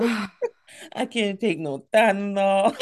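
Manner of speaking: slow lisped "I can't take no Tylenol"; lisping imitation. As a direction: imitate a sick lisp, slowly. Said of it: "when it gives you a lisp".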